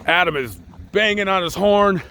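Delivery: normal talking voice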